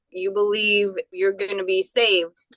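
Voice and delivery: ordinary delivery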